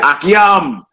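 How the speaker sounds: Akiyam called out high, as ah-kee-AHM; vowelless-style pronunciation. Retold or imitated. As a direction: raise "Akiyam" high, ending in open AHM. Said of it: "So you hear brothers say that".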